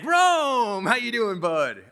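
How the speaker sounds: put-on high jovial hello from the old boss; hearty old boss. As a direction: imitate the boss greeting him cheerfully. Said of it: "He's like".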